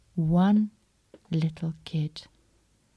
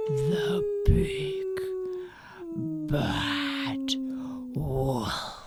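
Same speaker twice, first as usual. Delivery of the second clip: spooky big bad wolf; 9 semitones higher, much slower than usual. A narrator's reading. Dramatic reveal to children.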